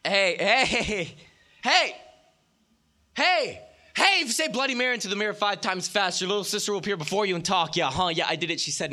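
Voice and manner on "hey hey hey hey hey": loud call. Acted. Shouted call to the crowd.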